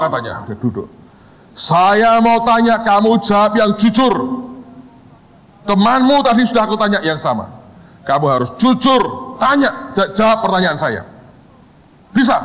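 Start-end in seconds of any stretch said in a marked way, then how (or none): 1.5-12.3 high, deliberate mock questioning; acted stern interrogator. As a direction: press a student with theatrical authority. Boss questions the second student.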